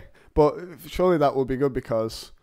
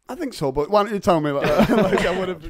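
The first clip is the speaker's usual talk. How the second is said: laughing; 6 semitones higher, 3 dB louder than usual. Acted. Mock reply, laughing.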